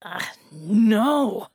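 loud displeased outcry; crying out. A narrator's reading. Bela rejecting her flat plea, a loud cry. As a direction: burst out in protest. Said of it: "Bela cried".

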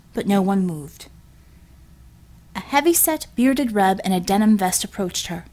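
usual speaking voice

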